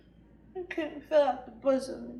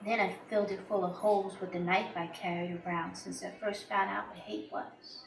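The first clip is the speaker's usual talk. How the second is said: low, hushed storytelling; quiet confession; 4 semitones lower, 8 dB quieter than usual. Acted. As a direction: confide a dark secret, softly. Dark confession opens the monologue.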